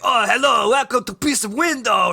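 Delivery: loud, high Russian show-host greeting; hammed-up Russian accent, projected. Pitching a YouTube show to co-host, hammed-up Russian host. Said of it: "like, a Russian guy".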